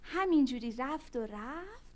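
usual speaking voice

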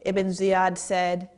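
usual speaking voice

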